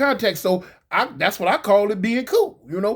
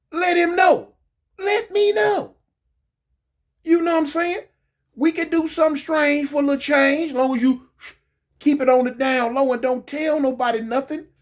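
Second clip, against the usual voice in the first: much higher, teasing pitch; mock come-on; 7 semitones higher than usual. Inmate sweet-talking his boss lady, high and teasing. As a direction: sweet-talk her in a high taunting voice.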